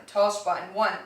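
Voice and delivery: ordinary talk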